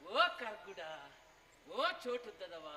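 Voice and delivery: low, drawn-out taunt; comic jeering call; teasing name-calling. Acted. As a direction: call the nickname mockingly.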